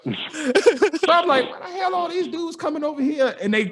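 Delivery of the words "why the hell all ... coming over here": incredulous. Retold or imitated. Teller mocking his own baffled reaction, high and incredulous. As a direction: squeak it out in mock outrage.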